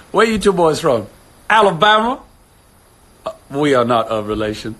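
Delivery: put-on film quote in Southern Alabama accent. Quoting Forrest Gump to viewers, Alabama accent. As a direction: act out the Southern movie exchange. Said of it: "Oh, man, Forrest Gump".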